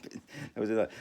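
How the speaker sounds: normal talking voice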